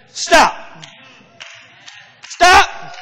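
loud, high commanding cry of Stop. Acted. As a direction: stop the knife with a ringing call. God halts Abraham's knife.

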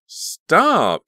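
loud, high, stretched cry; scream. A narrator's reading. Caroline trying to stop her friends fighting, screaming. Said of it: "Caroline screamed".

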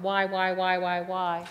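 loud, repeated exasperated whys. Retold or imitated. Flo scolds the pastor, loudly exasperated. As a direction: demand it, smacking her. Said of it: "the one who smacked me".

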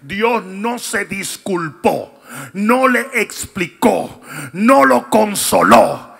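slow, loud preacher's proclamation; full-force preaching. Acted. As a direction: hammer each phrase home from the pulpit.

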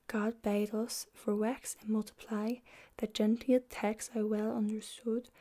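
whispered Middle English reading; library whisper. Acted. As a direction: read the old verse in a hushed whisper. Reads Chaucer aloud in whisper.

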